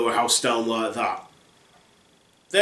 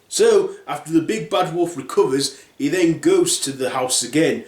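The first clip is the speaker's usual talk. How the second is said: ordinary delivery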